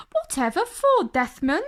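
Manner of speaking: put-on high, lisping girl monkey asking why; a light lisping friend, puzzled; Bubbles, puzzled. Bubbles asking Desmond why she should watch, high and lisping.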